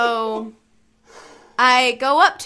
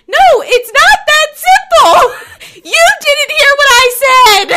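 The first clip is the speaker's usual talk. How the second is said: laughing shout; 12 semitones higher, 11 dB louder than usual. Retold or imitated. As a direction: yell it back at the friend through laughter. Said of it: "And I'm like".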